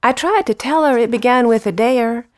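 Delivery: measured storytelling recital; poem reading. Acted. Poet recalls mother correcting her speech, measured recital. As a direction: read the verse with pauses between lines. Reads Southern Accent poem.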